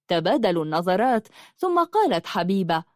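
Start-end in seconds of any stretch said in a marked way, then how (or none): none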